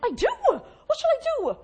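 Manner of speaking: high, loud, eager yeahs; eager mock agreement. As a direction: jump at the idea like a keen friend. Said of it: "You're like".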